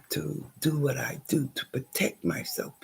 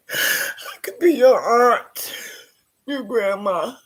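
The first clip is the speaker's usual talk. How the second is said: tearful plea, higher and louder; crying; 5 semitones higher, 10 dB louder, slower than usual. Real, not acted. Pleading with viewers to defend women, crying out. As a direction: voice breaking as she begs.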